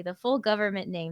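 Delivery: ordinary talk, nothing marked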